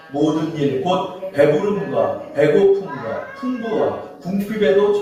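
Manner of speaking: slow unison Bible reading; reading scripture together. Real, not acted. Pastor leading the church in reading Philippians, slow and measured. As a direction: read each phrase together, slowly. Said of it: "우리 한번 합독하고 해보겠습니다".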